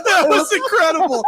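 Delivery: high, helpless laughing words; laughing hard. Real, not acted. Host reacting to a guest's awkward remark, laughing hard. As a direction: crack up while getting the words out.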